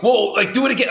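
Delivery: high, loud amazed plea; like a kid after a magic trick; excited. Retold or imitated. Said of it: "I was like".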